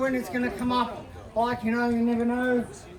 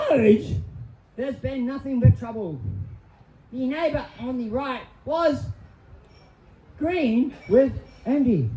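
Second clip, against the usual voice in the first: ordinary talk, nothing marked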